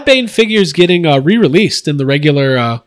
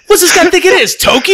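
high laughing yell; hammed-up mocking shout; 11 semitones higher, faster than usual. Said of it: "they're like".

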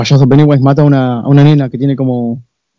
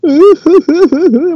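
shrill clown cackle, very high; very high mocking laugh; high creepy giggle. Clown laughing in retold scene.

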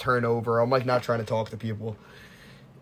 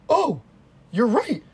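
high, exaggerated revelation; mock realization; 10 semitones higher, slower than usual. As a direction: act out a big dawning realization. Acting out mock sudden realization.

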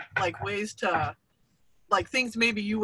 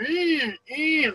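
high nasal-free "eh" revving up and cutting off; imitated engine rev; 9 semitones higher than usual. Neighbor's yard tool mocked as a high revving hum. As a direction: mimic the neighbor's machine revving. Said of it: "they go like this".

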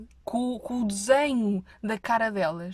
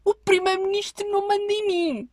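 higher, louder defiant whine; mocking the anti-maskers; 11 semitones higher, 6 dB louder than usual. Mimicking anti-mask posters on social media, high mocking defiance. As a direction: sneer it like a smug rebel online. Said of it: "a dizer".

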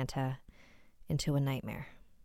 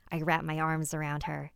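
ordinary delivery

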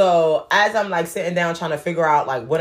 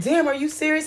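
higher, stunned damn; shocked disbelief; 10 semitones higher than usual. Retold or imitated. To the stranger who slept through boarding, shocked. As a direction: react like you can't believe it. Shocked at his missed flight.

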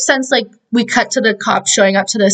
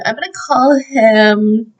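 ordinary delivery

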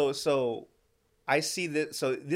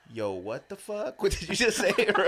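shocked; 7 semitones higher, 4 dB louder than usual. Retold or imitated. Retold shocked reaction.

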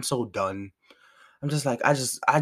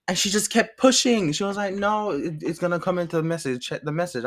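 higher, worked-up retelling of the argument; exasperated retelling; 7 semitones higher, 4 dB louder than usual. Retold or imitated. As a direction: pitch rises as the frustration comes back.

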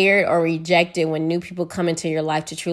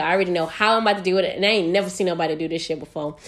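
normal talking voice

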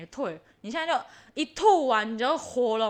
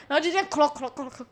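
usual speaking voice

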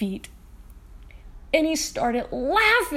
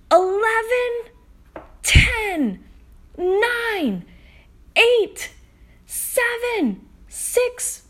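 higher and louder countdown; energetic countdown to zero; excited countdown; 8 semitones higher, 6 dB louder, much slower than usual. Shown as a demonstration. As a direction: build to blast-off. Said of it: "Let's count down in English".

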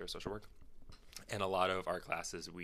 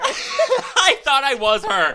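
slow, loud, laughing through words; drawn out while cracking up; 21 dB louder, slower than usual. Real, not acted. Laughing admission.

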